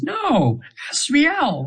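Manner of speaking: lightly high angel voice, correcting a name; the angel gently fixes how her name is said; angel's correction. Retold or imitated. Correcting the client on her name, a mild high angel voice. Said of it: "and she says".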